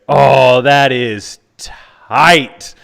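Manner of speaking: loud, slow, drawn-out awe; impressed moviegoer. Retold or imitated. Theater fan reacting to fight scene, loud slow awe. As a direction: marvel loudly at a kung fu fight. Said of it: "he was doing the same thing".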